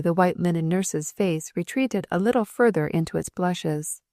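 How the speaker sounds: ordinary delivery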